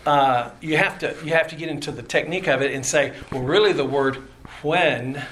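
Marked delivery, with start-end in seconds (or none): none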